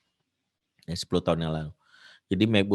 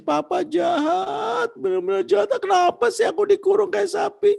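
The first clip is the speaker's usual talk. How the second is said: hammed-up teen crying at papa; crying child; 5 dB louder than usual. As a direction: whine it like a sobbing teen. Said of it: "si anak langsung nangis".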